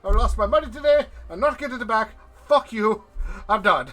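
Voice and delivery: loud, high-pitched dwarf rant; angry tirade. Acted. Dwarf who lost his money quitting, angrily ranting. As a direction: rail at them, high and loud. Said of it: "he's just going off this tirade".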